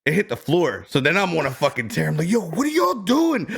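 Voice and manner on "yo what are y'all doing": high, sharp retold snap at the doctors; a half-mocking replay of losing it, much higher; snapping. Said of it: "I'm snapping".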